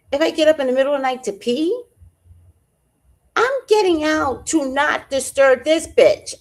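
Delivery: woman ranting about sneaking out of bed, high and loud; exasperated. Real, not acted. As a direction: vent about the needy cat. Exasperated rant.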